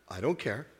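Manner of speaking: low, quiet deadpan dismissal; understated comic shrug in the voice; retold brush-off. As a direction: drop it low and wave the objection away. Retelling own brush-off to objection.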